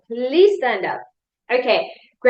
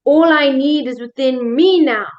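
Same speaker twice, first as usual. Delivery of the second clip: slow, powerful affirmation peaking on "me"; stress on ME; 6 dB louder, slower than usual. Shown as a demonstration. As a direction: punch "me", then fall on the last word. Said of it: "I'm gonna change the emphasis".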